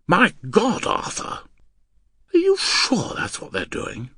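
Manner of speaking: high, breath-catching exclamation; exclaim, then question in high disbelief; shocked. Acted. Wife realising the neighbours are cheats, shocked.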